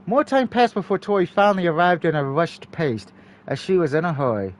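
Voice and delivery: ordinary delivery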